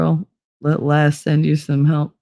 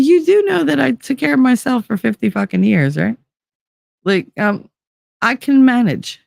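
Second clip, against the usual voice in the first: higher-pitched, mildly indignant comeback; indignant retort; 7 semitones higher than usual. Retold or imitated. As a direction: push back at doubting kids, pitch up. Indignant retort to worried kids.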